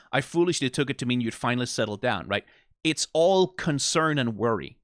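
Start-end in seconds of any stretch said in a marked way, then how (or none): none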